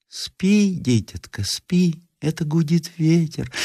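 put-on high, soft old granny voice; soothing grandmother. A narrator's reading. Grandmother hushes grandson at midnight, soothingly. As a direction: lull the child back to sleep.

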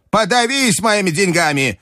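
high, cross granny voice easing slightly; shouting grandmother. A narrator's reading. Grandmother scolding clerk then ordering Aleksei, cross. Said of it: "крикнула бабушка".

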